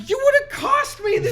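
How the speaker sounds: high-pitched pleading complaint; exasperated retold squeal; retold indignant protest. Retold outraged protest to girlfriend.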